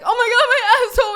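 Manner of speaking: loud, high-pitched wail of mock agony; mock screaming. Retelling the painful mishap in the car, mock screaming. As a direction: imitate screaming out in pain. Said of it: "and I'm screaming".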